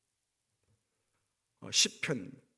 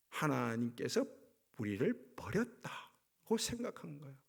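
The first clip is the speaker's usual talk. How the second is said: usual speaking voice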